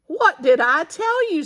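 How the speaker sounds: high, loud triumphant told-you-so. A narrator's reading. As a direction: crow about being right.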